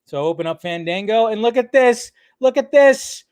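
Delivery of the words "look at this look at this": rising, high, thrilled urging; excited showing-off. Real, not acted. Streamer showing viewers the theater times, excited. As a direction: make them look, voice climbing with delight. Showing off cinema listings, delighted.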